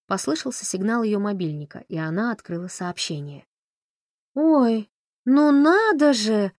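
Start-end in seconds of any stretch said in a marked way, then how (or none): 4.3-6.5 high, loud, slow affected drawl. A narrator's reading. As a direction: stretch it out theatrically, loud and high. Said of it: "манерно протянула она".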